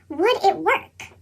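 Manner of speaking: usual speaking voice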